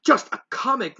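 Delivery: incredulous retort, voice jumping up; balking. A narrator's reading. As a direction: balk at it, offended on Rarity's behalf. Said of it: "Rarity balked".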